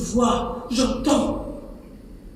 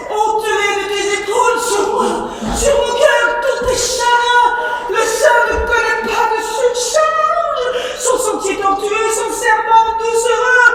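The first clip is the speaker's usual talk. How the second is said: high anguished shout; 6 semitones higher than usual. Acted. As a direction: cry it out, begging her. Nourrice suppliante, criant. (la nourrice supplie sa fille en criant)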